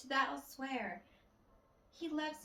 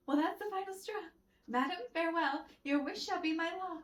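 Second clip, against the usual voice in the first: indignant farewell; 4 semitones higher, 4 dB louder than usual. Acted. Rejected lover storms off.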